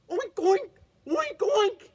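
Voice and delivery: high loud oinking; calling pig oinks. Acted. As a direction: oink like a pig to lure the monster out. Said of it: "he called".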